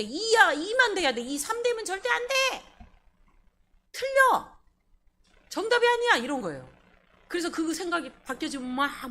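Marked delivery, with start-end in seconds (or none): 0.0-6.2 high, loud, dogmatic protest; mock angry insistence. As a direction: act out someone furiously refusing any other answer. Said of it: "막 화내, 화내".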